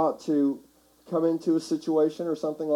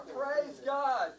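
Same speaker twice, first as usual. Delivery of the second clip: high, rousing shout; rallying cry for the crowd to echo; 9 semitones higher, 7 dB quieter than usual. Shown as a demonstration. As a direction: shout like a fan at a touchdown. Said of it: "I want all of us to shout".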